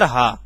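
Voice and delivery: "हा" said low from the throat; breathy ha demo. Shown as a demonstration. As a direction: sigh out "हा". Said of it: "आप लोग हा बोलना sir".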